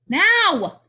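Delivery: high, sudden burst on one word; exasperated yell. Acted. Teacher repeating order to kids, exasperated yell. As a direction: lose patience and yell the order.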